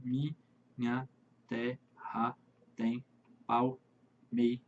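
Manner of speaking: slow, soft, syllable by syllable, stopping at the last stress; poetic scansion. Shown as a demonstration. Teacher scanning the verse softly for students, syllable by syllable. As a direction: count the verse out slowly and softly. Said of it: "Ficaria dessa maneira".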